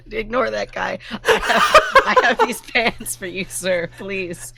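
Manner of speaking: high, laughing plea; pleading. Acted. Thief begging the captain through laughs. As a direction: beg while cracking up. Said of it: "I'm pleading to the captain".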